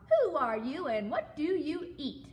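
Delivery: high, loud demand; brash frog calling out; shouted. A narrator's reading. Frog questions knobby brown log, shouted. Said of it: "shouted the wide-mouthed frog".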